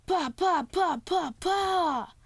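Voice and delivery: punchy pretend fight noises, one after another; childlike playful blows; imitated hit noises.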